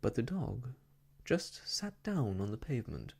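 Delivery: usual speaking voice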